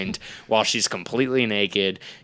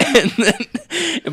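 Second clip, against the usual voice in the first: words caught in laughter; laughing; 6 dB louder than usual. Real, not acted. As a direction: laugh through it. Laughing fit.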